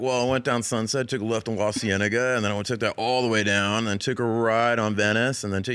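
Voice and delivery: reciting routes like a local; California dude. Retold or imitated. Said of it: "always say how we got places".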